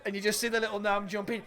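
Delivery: ordinary talk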